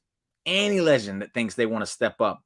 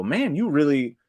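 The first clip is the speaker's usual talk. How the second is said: impressed wrestlers; 9 semitones higher than usual. Retold or imitated. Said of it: "and they go".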